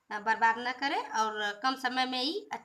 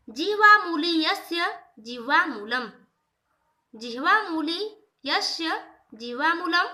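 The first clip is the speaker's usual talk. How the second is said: slow, louder chanting of the Sanskrit rule; sutra recitation; 4 semitones higher, 5 dB louder than usual. Shown as a demonstration. Teacher reciting the rule to students, slow and louder. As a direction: intone the sutra slowly for the students.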